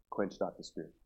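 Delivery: soft, measured answer; solemn refrain. Real, not acted. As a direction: drop the voice and state it simply. Solemn refrain answering loud questions.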